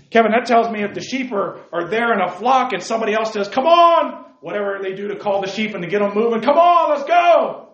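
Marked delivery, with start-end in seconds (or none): 3.5-4.3 high, loud herding call. Pretend. Stranger calling the sheep. 6.4-7.7 high, loud herding call. Pretend. Stranger calling the sheep.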